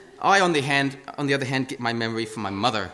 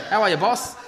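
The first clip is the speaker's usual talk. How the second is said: bright, higher, louder hello; cheery greeting; 7 semitones higher, 5 dB louder than usual. Retold or imitated. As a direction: greet a customer breezily. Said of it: "Even the ladies".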